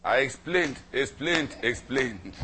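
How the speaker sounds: loud and high, fading on each repeat; insistent repetition. Real, not acted. The preacher stressing how long he had to explain, insistent then fading. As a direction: hammer it, then let it drop.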